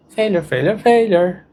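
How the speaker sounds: a light, lilting cartoon voice on failure; sing-song the word like a cartoon, lightly. Shown as a demonstration. Therapist demonstrates a funny cartoon voice for the scary word. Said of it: "use a funny cartoon voice".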